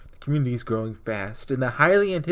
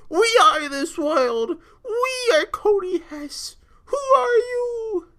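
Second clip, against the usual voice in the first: slow, high, tearful cries; crying hero; 4 dB louder, slower than usual. Acted. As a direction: sob out each line slowly. Hero breaking down in tears.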